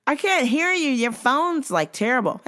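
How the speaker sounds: squeaky mock customer. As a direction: play the clueless caller. Caller voiced in retelling.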